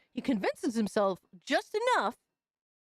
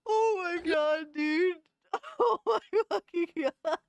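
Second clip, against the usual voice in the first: high-pitched laughter through words; laughing; 7 semitones higher than usual. Real, not acted. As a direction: laugh it out, can't stop. Cracking up at a clip.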